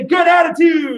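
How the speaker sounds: high, loud call to the class. Shown as a demonstration. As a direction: belt it out so they match you. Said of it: "I know you can be louder than that".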